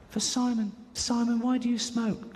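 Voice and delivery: put-on high, slow little-girl voice; a small child asking innocently. Girl asks Simon Cowell why he smokes, high and slow.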